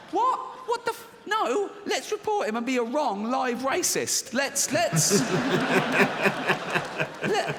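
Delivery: high, loud, outraged inner voice; exasperated inner voice. Retold or imitated. Comedian voicing his outraged inner voice, high and loud. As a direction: blurt it out in disbelief. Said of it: "inner voices arguing, exasperated".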